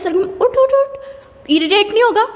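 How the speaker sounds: a teasing mom getting shriller with each uth; mock sweet wake-up. Mom with wet hands waking her daughter, rising sing-song. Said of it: "फिर बोलती है".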